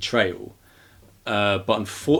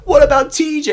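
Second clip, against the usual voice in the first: high, loud indignant cry; Sandy scolding Lexi in a high, loud outraged voice; indignant whine; 8 dB louder than usual. Retold or imitated. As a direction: shriek the name in outrage. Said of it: "she's like".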